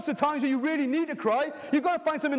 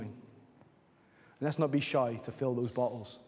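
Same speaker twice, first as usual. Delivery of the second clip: tender exhortation; 8 semitones lower than usual. Acted. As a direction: coax them gently to let the tears out.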